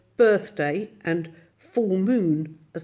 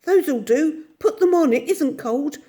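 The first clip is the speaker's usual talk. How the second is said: put-on higher, louder eager girl urging him on; urgent girl; 9 semitones higher, 5 dB louder than usual. Jane hustling Michael out the door, higher and urgent. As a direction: hurry him along, excited.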